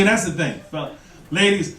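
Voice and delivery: ordinary delivery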